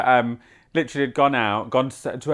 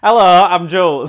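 louder, higher northern greeting; northern accent; 6 semitones higher, 8 dB louder than usual. Acted. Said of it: "Let me start that again, a bit more northern".

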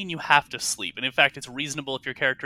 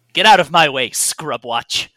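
a captain's barked order, louder; gruff command; 9 dB louder than usual. Acted. Captain orders Zelnern out of his path, gruff and loud. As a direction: shove the words at him like a captain. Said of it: "looks at you and says".

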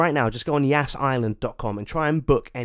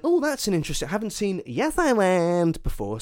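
mock intrigued; 6 semitones higher, 3 dB louder than usual. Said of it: "And I went".

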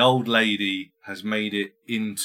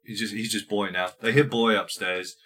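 ordinary talk

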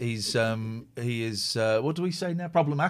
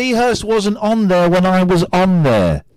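shouting; 4 semitones higher, 10 dB louder than usual. Real, not acted. Exasperated outburst about Lee Hurst.

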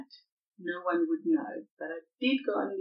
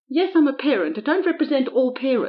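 protesting; 7 semitones higher, 8 dB louder than usual. Real, not acted. Pushing back under questioning.

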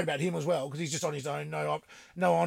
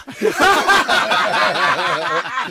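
loud high laugh; 6 semitones higher, 8 dB louder than usual. Real, not acted. Storyteller cracking up at the brother-in-law's threat, loud laugh.

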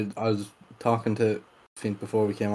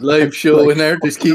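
announcer voice; 4 semitones higher, 9 dB louder than usual. Pretend. Announcing podcast live show.

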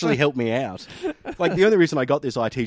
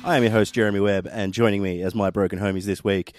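podcast host intro; 4 dB louder than usual. Acted. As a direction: welcome listeners like a radio host. Podcast intro over music.

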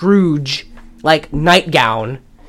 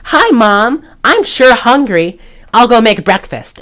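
put-on higher, slow eager little boy; wholesome boy voice; 6 semitones higher than usual. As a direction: play the cheerful son asking for breakfast. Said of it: "And he's like".

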